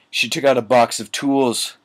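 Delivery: usual speaking voice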